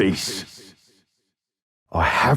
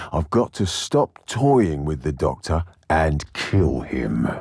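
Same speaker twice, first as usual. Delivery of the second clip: ordinary delivery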